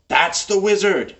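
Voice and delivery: high, loud combat call-out; bad guy yelling. Bad guy to his allies mid-combat, yelling. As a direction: yell a warning across the battle. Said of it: "yell at each other on their turns".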